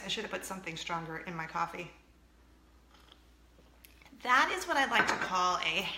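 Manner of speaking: ordinary delivery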